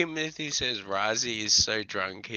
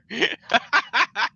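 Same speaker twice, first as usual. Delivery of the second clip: high laugh; 12 semitones higher, 4 dB louder than usual. Real, not acted. Keeps laughing after insult.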